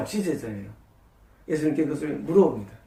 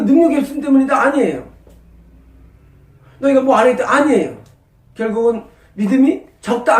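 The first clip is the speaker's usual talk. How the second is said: loud, rising sermon climax; preaching at full force; 3 semitones higher, 5 dB louder than usual. Acted. Pastor drives home faith to the church, loud full-force preaching.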